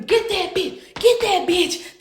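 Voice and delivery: high, drawn-out mock scream; stretched, high-pitched imitation of screaming; retold scream. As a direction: imitate a distant scream without real volume. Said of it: "I hear in the back screaming".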